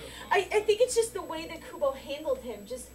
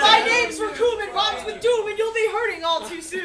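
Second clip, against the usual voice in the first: loud, higher villain boast; hammy villain pose; 5 semitones higher, 9 dB louder than usual. Retold or imitated. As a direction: boast like a posing anime henchman. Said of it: "The Ginyu Squad!".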